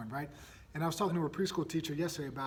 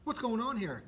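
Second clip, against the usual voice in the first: higher, startled questions; shocked, asking where she heard it; surprised parents; 7 semitones higher than usual. Retold or imitated. Parents startled by their daughter's rude words. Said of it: "And we're like".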